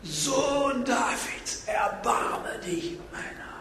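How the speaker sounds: soft, very drawn-out high call; restrained imitated cry. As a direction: stretch the plea out like a far-off shout, fading. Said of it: "Er schrie umso lauter".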